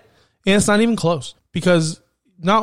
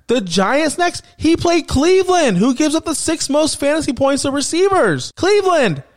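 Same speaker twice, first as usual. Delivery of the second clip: much higher and louder, fired up; heated, high-pitched outburst; passionate rant; 8 semitones higher, 3 dB louder than usual. Real, not acted. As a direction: rant like a betrayed fan. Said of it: "I'm saying it with some enthusiasm".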